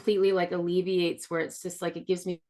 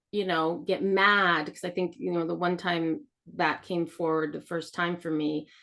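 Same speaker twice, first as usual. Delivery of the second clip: ordinary talk, nothing marked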